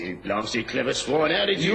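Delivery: enraged cornered villain; furious outburst. Acted. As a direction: spit out the insult at the detective. Cornered criminal rages at Holmes.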